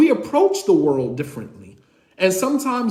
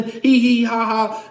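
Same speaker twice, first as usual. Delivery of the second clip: mock waitress giggle, a little louder; imitated giggle; 4 semitones higher, 5 dB louder, slower than usual. Waitress giggling at the table, mock giggle. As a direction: toss off a light flirty hee hee.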